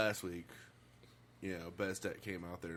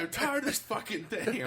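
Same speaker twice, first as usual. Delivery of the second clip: slow, higher fed-up complaint; fed-up engineer; 12 semitones higher, 5 dB louder than usual. Retold or imitated. Engineer complaining about the dish pit, fed up. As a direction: drag it out, sick of it.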